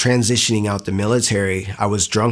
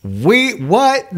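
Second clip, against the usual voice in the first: surprised; 12 semitones higher, 3 dB louder than usual. Retold or imitated. Retold disbelief at news.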